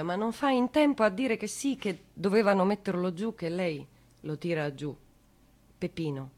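ordinary talk

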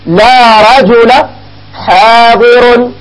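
each word drawn out very slowly; slow demo. Shown as a demonstration. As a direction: spell the example out slowly for students. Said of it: "مثلًا تقول". (مثال يُنطق ببطء شديد)

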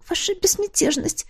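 high frightened squeak; squeaking maid. Acted. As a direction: squeak it in alarm. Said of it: "пискнула Маура".

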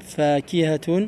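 "Fakihatun" drawn out slowly, every syllable sounded; slow teacher's demonstration of "Fakihatun"; careful model pronunciation. Shown as a demonstration.

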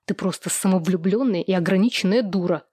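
ordinary delivery, nothing marked

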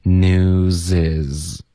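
"News is" run together slowly as "new-zis"; slow linking demo, "News is" as one word; linked news is. Shown as a demonstration. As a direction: stretch "News" and glide its z into "is". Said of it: "然后news跟is会连读".

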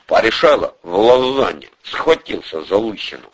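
plain reading voice

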